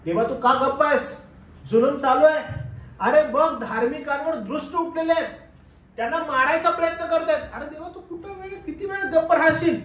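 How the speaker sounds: high, loud exasperated plea; pleading complaint. Acted. Prophet protests God's silence, high loud plea. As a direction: demand an answer from God.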